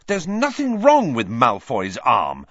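loud, slow, high-pitched anger; angry boy insisting loudly; furious. A narrator's reading. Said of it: "said Harry furiously".

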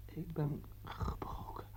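slow, spent whisper; exhausted player whispering his defeat; broken whisper. Acted. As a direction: whisper it with nothing left.

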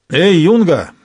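loud brutish shout; orc. Acted. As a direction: bark it down at the boy. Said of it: "разорвал тишину ненавистный Басторка".

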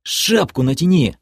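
cross, hissed interruption; angry hiss. A narrator's reading. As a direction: hiss the order, losing patience. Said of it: "прошипел я".

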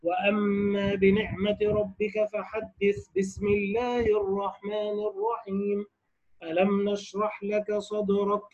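slow, soft recitation, "الرحيم" joined to the end of the sura, then a pause before "ألم"; forbidden recitation way. Shown as a demonstration. Teacher showing students the forbidden way, slow soft recitation. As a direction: recite it the wrong way, the basmala tied back to the previous sura. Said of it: "لا، هذا وجه ممتنع".

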